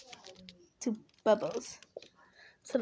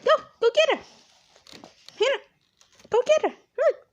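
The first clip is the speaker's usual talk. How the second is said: high, eager call to a pet; talking to a dog; 11 semitones higher, much faster than usual. Real, not acted. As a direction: coax the dog to fetch. Kid urging dog to chase bubbles.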